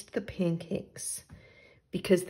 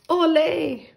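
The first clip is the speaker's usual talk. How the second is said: high, bright Ole shout; cheer; 8 semitones higher than usual. Acted. Puddle jumping into the pond, cheering. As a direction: cheer like a little matador. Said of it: "he cheered".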